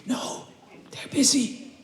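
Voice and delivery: high unvoiced whisper; child's whisper. Child whispers to the caller that the guards are busy. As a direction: hush it like a secret.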